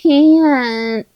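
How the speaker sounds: put-on drawn-out child's whine; whining. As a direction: mimic a kid whining I can't. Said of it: "We've all heard somebody whining".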